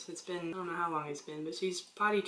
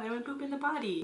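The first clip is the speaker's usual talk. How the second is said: put-on higher, quick proud little-girl boast; proud toddler; 8 semitones higher, faster than usual. Mom imitating her daughter proudly announcing her success. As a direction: brag like a toddler who thinks she did well. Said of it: "she's all proud".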